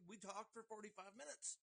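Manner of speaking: high, goofy insistent voice; a lightly voiced stranger from camp; a stranger from camp insisting they talked, in a high goofy voice; mock stranger. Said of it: "they're like".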